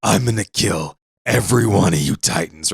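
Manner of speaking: understated furious vow; vowing revenge. Retold or imitated. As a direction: swear vengeance through tears, a touch hammed-up. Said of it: "goes to the edge of the boat and says".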